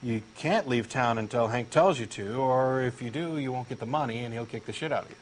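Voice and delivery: usual speaking voice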